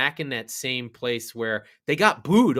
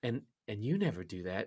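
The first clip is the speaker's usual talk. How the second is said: much softer, low lightly voiced hurt girlfriend; hurt hush; 3 semitones lower, 11 dB quieter than usual. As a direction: say it quietly, stung and let down.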